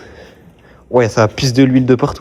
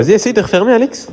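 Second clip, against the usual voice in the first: high call to a friend; 9 semitones higher than usual. Real, not acted. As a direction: call out to him, higher. Calling out to Alex.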